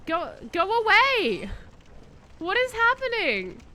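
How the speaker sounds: high, loud and slow "Go, go away"; alarmed shout. Real, not acted. Streamer yelling at a bug attacking him. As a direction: shoo the bug off in a panic.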